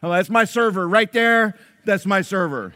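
loud, high, bragging show-off; proud boasting. Retold or imitated. Proud husband bragging to the applauding restaurant, loud and high. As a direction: crow about her to the whole room.

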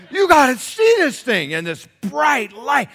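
loud, high, slow calling out; excited shout. Retold or imitated. Boys urging mom to see the light, loud excited shout. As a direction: call her over in wonder.

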